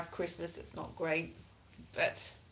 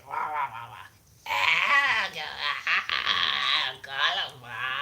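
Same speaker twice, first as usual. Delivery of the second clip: lower, warbling nonsense voices; voices in her head; 6 semitones lower, slower than usual. Pretend. Showing the voices she hears in her head, low babble. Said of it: "I just do all the voices in my head".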